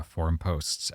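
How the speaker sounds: ordinary delivery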